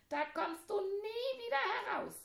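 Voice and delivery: very high sneaky taunt; high-pitched goblin gloating; giggling imp. Acted. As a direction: squeak it out like a gleeful gremlin. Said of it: "kicherte er".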